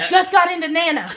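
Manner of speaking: high, loud retold outburst; mom's alarmed cry. Mom breaking the bad news to her daughter, alarmed cry. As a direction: call it out in mock alarm. Mom shouts from under the bed.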